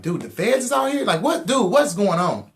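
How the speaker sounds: high, loud startled pleading; alarmed disbelief. Retold or imitated. Caught by the feds, startled and loud. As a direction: voice jumping up in shock at the feds. Panicked retelling of FBI stop.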